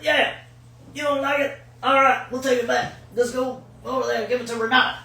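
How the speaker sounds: loud, high put-on boss voice; imitated big boss. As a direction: placate a customer at full volume. Big boss placates customer.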